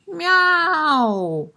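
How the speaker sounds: high, loud cat cry; insistent meow. Acted. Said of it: "insistent cries".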